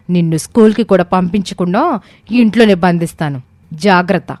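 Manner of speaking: firm, emphatic warning; stern threatening. A narrator's reading. Mother threatens her son over visiting that house, stern and menacing. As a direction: threaten sternly, leaning on the key words. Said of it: "గట్టిగా బెదిరించింది".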